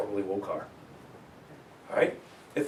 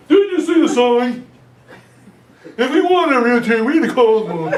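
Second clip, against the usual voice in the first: mock rude seller; 10 semitones higher, 14 dB louder than usual. Obnoxious seller rebuffing a realtor at the door, loud mock snap. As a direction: play the grumpy seller slamming a realtor, hammed up. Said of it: "They would come to the door and say".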